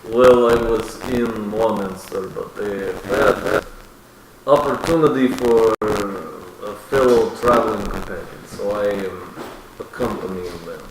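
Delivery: a hint of a gruff traveler; lightly voiced character. Player voices character's reply.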